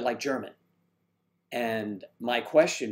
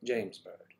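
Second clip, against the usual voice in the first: James Bond-style introduction; 3 semitones lower, 6 dB quieter, much slower than usual. Pretend. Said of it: "What's your name?" you say".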